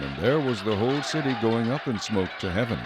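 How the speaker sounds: ordinary talk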